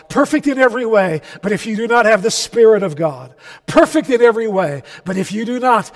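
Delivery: fast, forceful, rhythmic sermon build; impassioned preaching. Real, not acted. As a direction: hammer the repeated phrases at the congregation.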